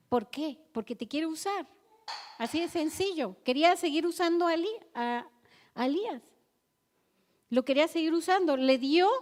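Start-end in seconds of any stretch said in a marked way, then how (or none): none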